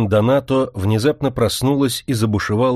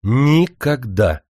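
slow, emphatic defiant shout; hot-tempered cry; 3 semitones higher, 3 dB louder, much slower than usual. A narrator's reading. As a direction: fling it back at him. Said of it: "запальчиво вскрикнула дочь".

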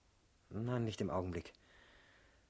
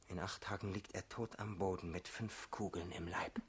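grim quiet vow; 5 semitones lower, 9 dB quieter than usual. Acted.

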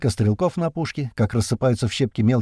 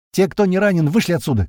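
high, loud order out; shouting; 7 semitones higher, 4 dB louder than usual. A narrator's reading. Medic girl yells at the unhurt to leave, loud high. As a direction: clear the room with a yell. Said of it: "кричит она".

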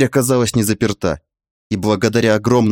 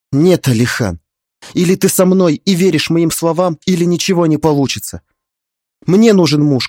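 higher, louder, tearful anger; spat out angrily; 8 semitones higher, 4 dB louder than usual. A narrator's reading. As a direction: spit the words at him through tears. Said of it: "Зло вытирая слёзы, выплюнула она". (зло выплёвывая слова сквозь слёзы)

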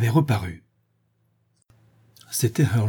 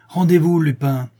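higher, louder command to surrender; shouted order; 6 semitones higher, 6 dB louder than usual. A narrator's reading. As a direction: bark the order with gun raised. Brigadier aims and orders.

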